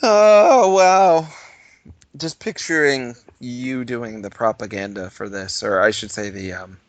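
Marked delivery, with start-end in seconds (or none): 0.0-1.3 high, drawn-out "oh, wow"; loud astonished reaction. Real, not acted. Hearing of cheap guns for children, loud astonishment.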